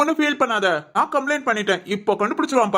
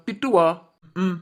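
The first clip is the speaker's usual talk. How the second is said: ordinary delivery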